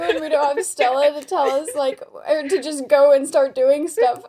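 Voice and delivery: usual speaking voice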